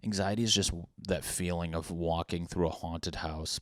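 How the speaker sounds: usual speaking voice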